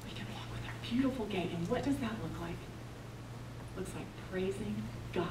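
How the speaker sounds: soft, low, near-whispered preaching; hushed. Real, not acted. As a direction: bring it right down to a hush. Hushed reflective question to congregation.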